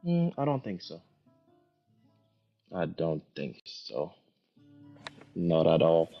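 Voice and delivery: ordinary talk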